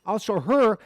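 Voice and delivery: normal talking voice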